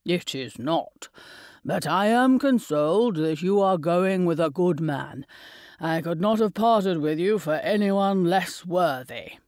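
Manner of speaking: a hint of a tender old man chiding his daughter; old father. A narrator's reading. Narrator voices the father.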